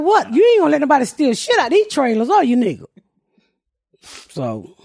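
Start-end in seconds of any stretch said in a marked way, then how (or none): 0.0-2.8 higher, indignant challenge; mock indignant. Pat challenging the trailer guard, higher and indignant. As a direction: act offended at the question. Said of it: "I was like".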